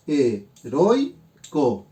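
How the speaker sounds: "Heroico" said very slowly, syllable by syllable, keeping "roi" together; slow syllable split. Shown as a demonstration. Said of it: "van a formar una sola sílaba". ("Heroico" muy lento, separando sílabas y uniendo "roi")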